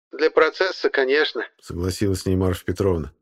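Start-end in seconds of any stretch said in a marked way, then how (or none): 0.1-1.5 put-on old woman voice. A narrator's reading. Marfa character voice.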